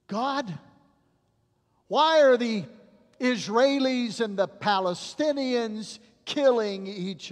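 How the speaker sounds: preacher voicing an angry prayer; shaking fist at God. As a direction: demand answers from heaven in anguish. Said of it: "Have you ever shaken your fist at God".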